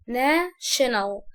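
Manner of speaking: national broken into "na" and "shenal"; split in two. Shown as a demonstration. Teacher breaking up the long word, national in two pieces. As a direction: slowly say national with a gap after "na". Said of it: "الكلمة لما تبقى كبيرة عليا اقسمها".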